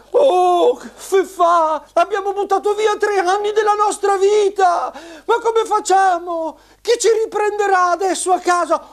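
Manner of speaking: high squeaky sad puppet; squeaky shrill puppet voice. Acted. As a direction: whine it like a sad little puppet. Cleo puppet laments to Fefa.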